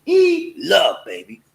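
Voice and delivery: normal talking voice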